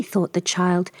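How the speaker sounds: ordinary delivery, nothing marked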